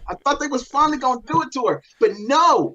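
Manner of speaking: high-pitched, aggrieved fan rant; raging out. Real, not acted. Fan venting that the favorite lost, high aggrieved rant. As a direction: complain in a high voice, wronged and incredulous. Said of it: "take this time to just rage out".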